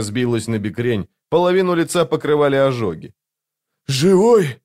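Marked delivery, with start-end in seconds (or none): 3.9-4.6 high, loud cry of relief; astonished, raised-voice exclamation; exhaled exclamation. A narrator's reading. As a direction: blurt it out on a breath, voice jumping up. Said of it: "выдохнул он".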